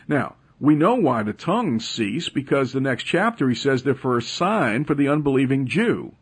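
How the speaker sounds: radio preacher warming to his argument; building preaching. Acted. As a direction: drive the point home from the pulpit.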